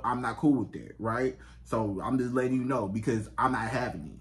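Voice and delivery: low, composed and firm; calm voice. Shown as a demonstration. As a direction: lay down the rule calmly, without yelling. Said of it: "in a calm, calm voice".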